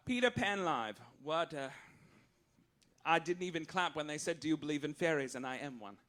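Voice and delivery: ordinary talk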